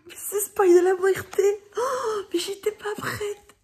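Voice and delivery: high, hammed-up tearful outcry; mock wail of dismay. Reader who spoiled the last sentence for herself, mock-devastated. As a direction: wail it as if the spoiler ruined everything.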